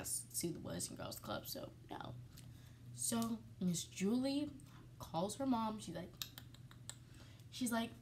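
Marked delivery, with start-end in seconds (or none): 0.0-2.1 whispered aside. Real, not acted. Aside about lawsuit.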